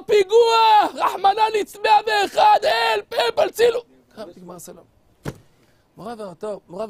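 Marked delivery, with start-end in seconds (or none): 0.1-3.8 very high, loud cries for help; hammed-up panic. Young Kahaneman screaming for help at the door, hammed-up. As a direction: scream for help in mock terror.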